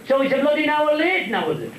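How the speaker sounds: high-pitched put-out passenger; comic indignation. Acted. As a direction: complain up high in mock outrage. Paddy complaining about the delay.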